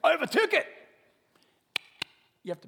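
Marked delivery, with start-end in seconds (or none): 0.0-0.7 loud, high-pitched insistence; acted protest. Preacher acting a would-be passenger with a ticket, loud protest. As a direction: blurt it out as a proud objection. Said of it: "You say".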